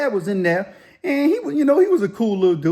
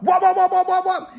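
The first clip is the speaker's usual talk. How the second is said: very high, repeated "wop" knife chops; imitated chopping noise; 12 semitones higher, 3 dB louder than usual. Dude chopping peppers with sushi knives, imitated chopping noise. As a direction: mimic two blades chopping, pitched way up.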